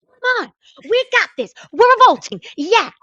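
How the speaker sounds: hype-up cheer. Retold or imitated. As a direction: pump up the kids in the wings. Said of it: "I'd be like".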